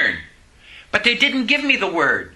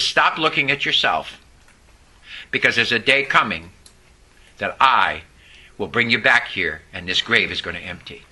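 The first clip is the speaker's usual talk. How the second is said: God's gentle reply; 10 semitones lower than usual. Retold or imitated. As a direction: speak it low and reassuring. God's voice quoted.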